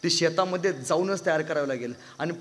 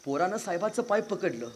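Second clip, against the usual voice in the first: ordinary talk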